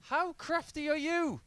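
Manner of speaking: high-pitched mock amazement at a crafty shot; mock incredulous. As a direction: voice the opponent's cheeky disbelief. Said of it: "It's as if to say".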